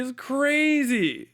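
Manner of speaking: normal talking voice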